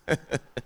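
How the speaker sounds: short pulsed chuckle; brief laugh at your own joke; teasing chuckle. Real, not acted. Laughs while teasing listener.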